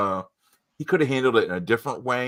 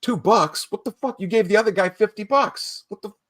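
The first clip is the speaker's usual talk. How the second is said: much higher, outraged mock voice; mock indignant; 10 semitones higher than usual. Ethan at the door mocking the visitor, high indignant voice. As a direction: play Ethan baffled at the tip. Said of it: "Ethan opens the door and he's like".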